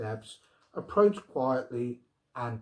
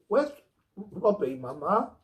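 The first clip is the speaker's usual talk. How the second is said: near tears; 7 semitones higher, 3 dB louder than usual. A narrator's reading. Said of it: "voice quavered on the verge of tears".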